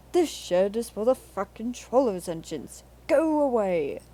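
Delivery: loud, angry hiss at intruders; Henry's hiss. A narrator's reading. Henry scolds the visiting engines, loud and hostile. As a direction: drive them out of his shed. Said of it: "hissed Henry".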